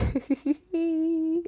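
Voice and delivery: high, soft nervous giggle. Real, not acted. Streamer flustered by a question about Eric, nervous giggle. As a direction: let an embarrassed giggle slip out. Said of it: "that's a nervous laugh".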